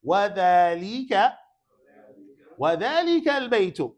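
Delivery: calling out a drill. Shown as a demonstration. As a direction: project each word for repetition. Modelling words for class repetition.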